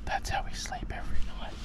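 real hushed whisper. Real, not acted. Night, child asleep beside them.

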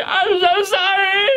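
put-on high, slow, crying old man; mock sobbing apology. Old man apologizing to T-Bone, high crying voice. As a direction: wail it like a broken old man. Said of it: "And he's like".

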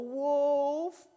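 high, loud yell. Acted. Boy showing how he will fool the villagers, yelling. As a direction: shout Wolf as a fake alarm. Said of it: "I'm gonna yell".

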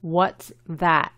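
"that" with short A and the final T held back; "that" ending without a full T; unfinished final T. Shown as a demonstration. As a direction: say "What's that?" word by word, T in "that" not completed. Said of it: "no se pronuncia completamente".

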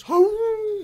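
a sudden high, loud whooping howl; a short, high, howling battle cry, hammed up; mock battle cry. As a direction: let out a wolf's war whoop. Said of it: "battle cry".

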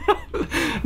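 high, squealing, near-crying laugh; panicked laughing. Real, not acted. Player too scared to go on, panicked laughing. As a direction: squeal helplessly through the laughter.

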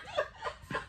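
high breathy burst of laughter; breathless laugh. Real, not acted. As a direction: let out a stunned airy laugh. Laugh after the shock.

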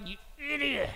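suppressed aside from an exasperated GPS; GPS's inner insult. Acted. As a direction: mutter it privately under your breath. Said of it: "what's going on in the little voice's head".

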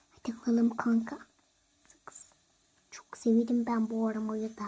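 hushed sneaky whisper. Acted. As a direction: whisper while sneaking the gum. Stealing gum, whispering. (gizlice fısıltı)

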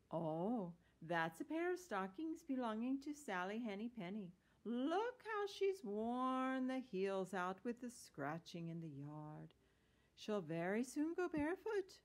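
fussy washerwoman. Acted. Voices Mrs. Tiggy-Winkle in story.

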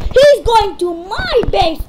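high, loud cry of alarm; alarmed shout. Real, not acted. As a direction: yell out that the enemy is coming. Alarm at enemy attacking base.

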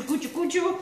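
soft mouth-noise chuku of a food chopper; imitated chopper noise. Cook mimics her chopper for viewers, soft chuku noise. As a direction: quietly mimic the chopper whirring. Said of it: "that does the cuchu".

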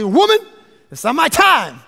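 a high, loud woman, then firmer; sharp reply. Retold or imitated. Jesus answers his mother at the wedding, loud and sharp.

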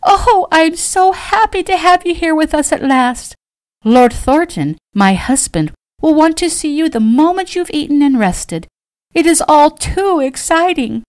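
high, loud ecstatic squeal; thrilled, high-voiced society mother; squealing with delight. A narrator's reading. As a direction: gush at top pitch, overjoyed. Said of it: "suddenly squealed with delight".

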